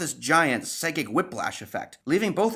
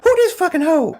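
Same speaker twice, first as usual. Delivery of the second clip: high, loud yelled question; mock outburst; 13 semitones higher, 11 dB louder than usual. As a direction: shout it in comic disbelief.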